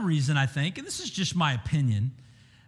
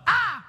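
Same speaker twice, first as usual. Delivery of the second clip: a sharp "Ah!" jumping much higher and louder, a gasp of shock; startled cry; 13 semitones higher, 4 dB louder than usual. Shown as a demonstration. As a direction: yelp "Ah!" as if suddenly startled. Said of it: "It wasn't so much of a laugh as much of a".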